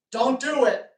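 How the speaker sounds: pleading. Real, not acted. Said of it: "scare the crap out of me".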